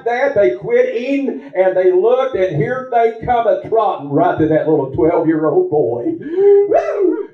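high, rising joyful preaching; excited preaching. Real, not acted. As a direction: let the joy lift the voice.

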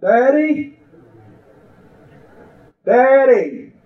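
high imitated child calling out for Daddy; child hollering. Little girl hollers to her father from bed, high imitated call. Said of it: "that little girl began to holler".

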